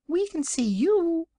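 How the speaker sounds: very high, tiny piglet squeak. A narrator's reading. Another invisible piglet answers the Wizard, tiny high voice. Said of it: "said another piglet".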